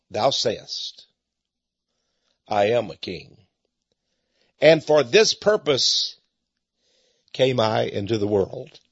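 very calm. Retold or imitated. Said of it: "it was a very calm".

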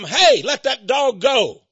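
loud, slow, commanding shout; retold shout. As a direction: bark the order across the street. Yelling at dog abuser.

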